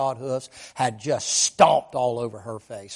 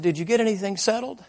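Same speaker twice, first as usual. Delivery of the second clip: high, louder insistence; urgent retold appeal; 9 semitones higher, 4 dB louder than usual. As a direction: press for one more moment. Preacher urgently stops the fleeing girl.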